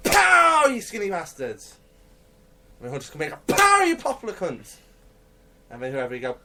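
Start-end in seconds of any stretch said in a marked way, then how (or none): 0.1-1.8 high, slow shouted taunt; mock fight cry. Acting out wrestling blows. 3.6-4.8 high, slow shouted taunt; mock fight cry. Acting out wrestling blows.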